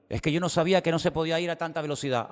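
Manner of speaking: high, fast, pleading excuse; whiny excuse. Retold or imitated. Driver caught speeding, pleading quickly in a high voice. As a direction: rush out a lame excuse to the cop.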